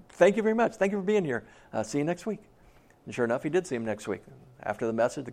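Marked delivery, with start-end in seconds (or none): 0.1-2.4 higher-pitched, awkward brush-off; flustered politeness. Acted. Puzzled pastor to tearful churchgoer, awkwardly polite. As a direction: thank him nervously and move him along.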